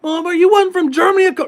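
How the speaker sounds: high incredulous squeak; mock disbelief. Mocking the fake German inmate, high and incredulous.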